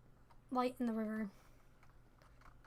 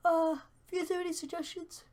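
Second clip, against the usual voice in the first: talking through a yawn; words stretched by a yawn; 5 semitones higher, 4 dB louder than usual. Real, not acted. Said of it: "Sorry about the yawn".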